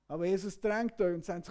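usual speaking voice

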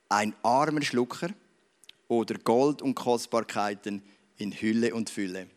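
put-on slow, measured reading from a children's history book; story read aloud. A narrator's reading. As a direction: read the tale aloud to the congregation, unhurried. Beispiel vorlesen aus diesen Büchlein.

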